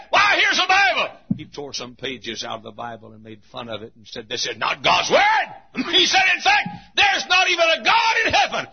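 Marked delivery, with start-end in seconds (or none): 0.1-1.2 high, loud scornful shout; mocking atheist. 4.3-8.7 loud, high scornful shout; defiant atheist. Acted. Atheist mocking the Bible.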